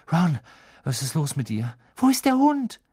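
urgent, rising to a high cry; alarmed. Acted. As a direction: call out in alarm. Harry rushing to injured friend.

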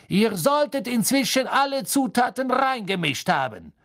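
hohe, laute Lehreransage; lautes Rufen. A narrator's reading. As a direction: streng über die ganze Klasse rufen. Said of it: "rief Snape". (laut und gebieterisch gerufen)

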